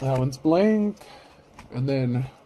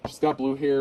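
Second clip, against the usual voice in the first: ordinary talk, nothing marked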